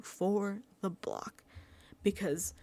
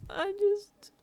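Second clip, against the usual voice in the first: much higher, fake sobbing; mock crying; 9 semitones higher, slower than usual. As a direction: fake a tearful stammer. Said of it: "trying to do the Vince routine of crying".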